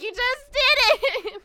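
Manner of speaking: slow, high, loud yell, breaking into laughter. Retold or imitated. Retelling the lady's outburst, laughing and slow. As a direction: laugh while playing her outrage. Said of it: "she got louder and angrier".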